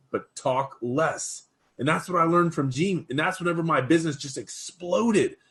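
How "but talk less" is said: slow advice. Retold or imitated. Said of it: "He said".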